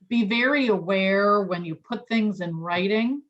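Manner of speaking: normal talking voice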